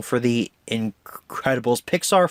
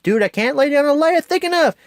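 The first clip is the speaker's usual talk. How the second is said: much higher, louder strained shout; Frozone straining; 3 dB louder than usual. Retold or imitated. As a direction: yell it over the fire, struggling. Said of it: "Frozone's like".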